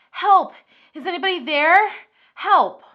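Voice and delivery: high, loud shouts for help; mock cry for help. Hidden victim calling out for rescue, mock shouting. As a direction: yell for help like a trapped victim.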